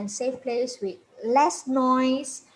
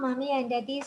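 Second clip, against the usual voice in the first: ordinary talk, nothing marked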